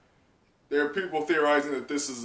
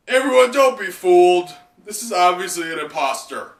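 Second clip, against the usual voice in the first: loud, higher shout to the others; Ashura's warning shout; 5 semitones higher, 10 dB louder than usual. Acted. Ashura warning comrades of an imposter, loud shout. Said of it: "Ashura Doji, is like me right now".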